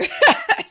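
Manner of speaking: high, loud laughter; giddy burst of laughter. Real, not acted. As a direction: laugh out high at your own joke. Cracks up at own comparison.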